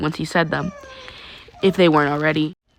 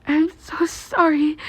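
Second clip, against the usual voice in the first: high, slow, trembling apology; girl's voice near tears; 10 semitones higher, slower than usual. Acted. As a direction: blurt it out as if about to cry. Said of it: "Yamamomo said suddenly".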